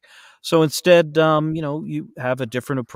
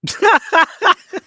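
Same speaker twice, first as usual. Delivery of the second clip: high burst of laughter; 10 dB louder than usual. Real, not acted. Caught off guard by request.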